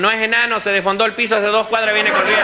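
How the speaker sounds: high, insistent explanation; passenger calling back. Acted. Passenger correcting the guard, high insistent voice. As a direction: pitch it high and keep it up to the punchline. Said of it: "Y le dice uno".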